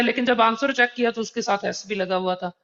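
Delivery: ordinary talk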